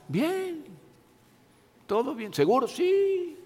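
high light questioning voice; mock small talk. Diálogo imitado de saludo. (diálogo fingido de saludo, voz aguda)

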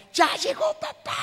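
big, high, slow joyful announcement; happy dad shout. Retold or imitated. Father cheerfully announcing himself to his kids, joyful shout. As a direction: call out like a beaming father coming home.

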